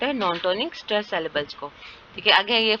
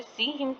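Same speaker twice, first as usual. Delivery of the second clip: "see" higher and stressed, "him" falling away; 5 semitones higher, 6 dB quieter than usual. Shown as a demonstration. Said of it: "सी पे stress है".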